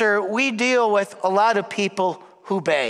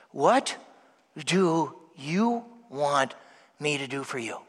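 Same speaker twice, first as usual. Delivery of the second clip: soft slow question; 8 semitones lower, 6 dB quieter, slower than usual. Real, not acted. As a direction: lower and softer, drawn out. Softer, slow repeat of question.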